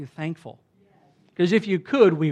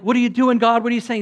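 loud, raised, rushing questions; frantic questioning; 6 semitones higher, 9 dB louder, faster than usual. Acted. Elijah amid the turmoil questions God, frantic and loud.

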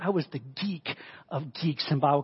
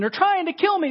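high-pitched frantic protest; mock-panicked complaint; desperate Elijah; 13 semitones higher, 4 dB louder than usual. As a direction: plead it in acted desperation. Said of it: "he's just in this place of desperation".

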